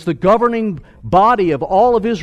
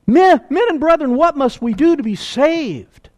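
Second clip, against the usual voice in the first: higher, louder desperate plea; the crowd begging Peter, desperate; crying out; 8 semitones higher, 5 dB louder than usual. Retold or imitated. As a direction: cry out for rescue. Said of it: "And they cried out".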